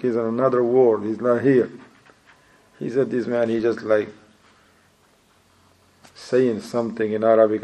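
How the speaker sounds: ordinary delivery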